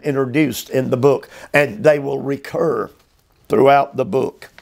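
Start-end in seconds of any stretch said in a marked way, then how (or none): none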